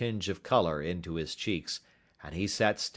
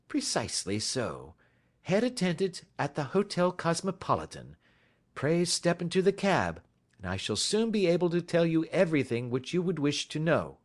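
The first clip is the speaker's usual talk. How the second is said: a hint of a brisk, higher gentleman; polite detective taking charge, lightly voiced; lightly raised gentleman's voice; lightly voiced Holmes; 9 semitones higher than usual. A narrator's reading. Holmes speaks.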